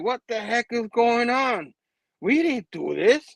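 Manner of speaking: mock indignation; indignant disbelief. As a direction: act out baffled protest, drawn out. Acting the Crime Master's outrage.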